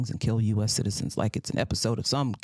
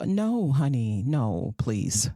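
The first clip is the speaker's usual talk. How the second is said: usual speaking voice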